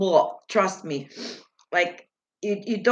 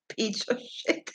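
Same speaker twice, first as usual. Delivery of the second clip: puzzled near-whisper; 8 dB quieter than usual. Shown as a demonstration. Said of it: "Yeah, just peach or shit".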